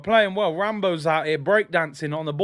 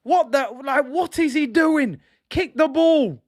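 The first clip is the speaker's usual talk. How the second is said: exasperated shout; 7 semitones higher, 3 dB louder than usual. Real, not acted. Yelling at keeper.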